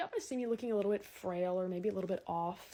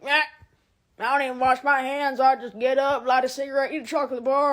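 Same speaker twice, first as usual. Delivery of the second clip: loud, grungy slob; 3 semitones higher, 10 dB louder than usual. Retold or imitated. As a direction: drone it like a Frank Gallagher type. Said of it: "disciples that are like".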